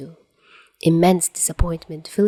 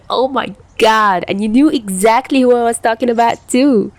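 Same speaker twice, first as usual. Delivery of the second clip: louder, higher, gleeful teasing; grinning; 6 semitones higher, 6 dB louder than usual. Acted. Tachihara teasing Chuya, grinning. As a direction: grin through every word. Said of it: "said with a wide grin".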